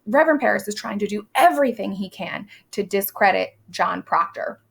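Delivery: ordinary talk, nothing marked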